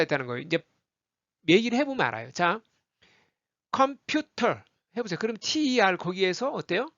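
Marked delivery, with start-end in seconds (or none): 3.7-4.6 컴퓨터 slowly with a crisp aspirated T; careful, fully released T in 컴퓨터; full T sound. Shown as a demonstration. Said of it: "터로 안 하고 컴퓨터 이렇게 소리 툭 놓아요".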